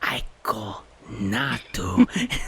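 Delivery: slow, halting syllable-by-syllable reading; sounding out words. Acted. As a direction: read it out slowly like a fourth grader. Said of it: "I sound out each word".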